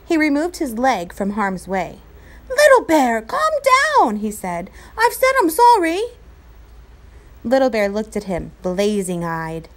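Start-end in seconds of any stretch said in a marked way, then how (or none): 2.5-4.2 high, loud pleading; urgent plea. A narrator's reading. Omri calming Little Bear. 5.0-6.0 high, loud pleading; insist, desperate; urgent plea. A narrator's reading. Omri pleading.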